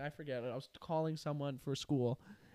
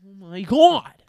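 loud exasperated outburst; mock shocked yell; 4 semitones higher, 17 dB louder, slower than usual. Embarrassed son reacting in the store, loud mock shock. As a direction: blurt it out mortified. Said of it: "I'm like".

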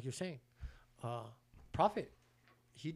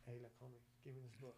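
quiet; 3 semitones lower, 17 dB quieter, faster than usual. Retold or imitated. Quoting himself, much quieter.

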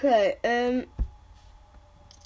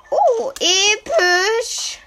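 high, slow, loud gasp of awe; drawn-out thrilled exclamation; excited; 12 semitones higher, 11 dB louder, much slower than usual. Real, not acted. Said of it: "Warum sag ich oh?".

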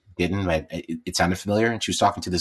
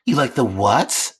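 put-on higher, questioning old-lady voice; puzzled old lady, drawn out; older lady; 5 semitones higher, much slower than usual. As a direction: imitate a baffled old woman, rising at the end. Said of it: "the older lady was like".

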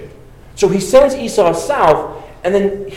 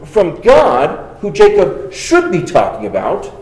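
ordinary talk, nothing marked

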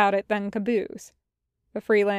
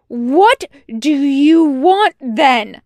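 slow, very loud angry demand; yelling; 5 semitones higher, 11 dB louder, slower than usual. A narrator's reading. Wash throws out his arms at Tucker, yelling. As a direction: yell it, stretching the words. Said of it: "he yells".

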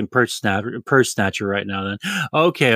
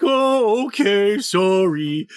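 mock sheepish Matsuda; 8 semitones higher, much slower than usual. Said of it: "he fucking scolds him like".

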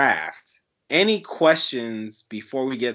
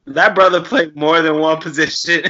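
laughing; 5 semitones higher, 6 dB louder than usual. Real, not acted. Joking remark while laughing.

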